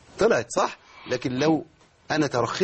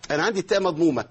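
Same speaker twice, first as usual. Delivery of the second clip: ordinary delivery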